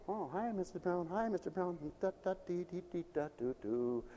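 soft singsong greetings and little da-dee tune; light, under the breath; acted carefree shopper. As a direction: toss off quiet hellos while pottering along. Acts cheery shopper greeting, humming.

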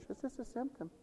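very soft, dismissive mock quote; mocking other preachers. Mocking preachers who deny illness, soft dismissive quote. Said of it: "And people preach".

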